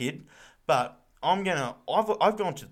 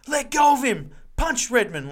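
high, loud fan shout; an angry fan screaming at the ground; fired-up fan yell; 11 semitones higher, 7 dB louder than usual. Retold or imitated. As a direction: yell it from the stands at a brawl. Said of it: "you'd be fired up".

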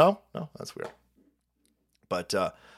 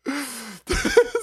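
laughing; 6 semitones higher than usual. Real, not acted. Laughing at rapper clip.

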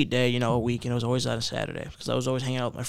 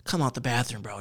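ordinary delivery

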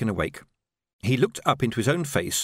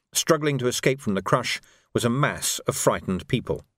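ordinary delivery, nothing marked

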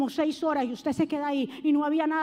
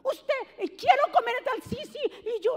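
put-on much higher, babbling spoiled-kid voice; bossy child; 9 semitones higher than usual. Mocking a spoiled child bossing a parent, babbling high. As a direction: mimic a spoiled child giving orders. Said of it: "Ahora son los hijos que mandan".

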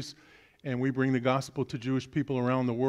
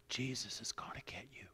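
ghostly whisper, a bit nearer; eerie whisper; 3 semitones lower, 17 dB quieter than usual. Acted. Voice in the dark house creeps closer, whispering. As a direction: hiss it from the shadows. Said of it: "it got a little louder".

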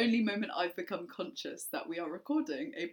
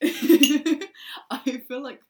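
breathless after a laughing fit; after laughing hard; 6 semitones higher, 11 dB louder, much slower than usual. Real, not acted. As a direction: still catching breath from laughing. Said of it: "I need to catch my breath".

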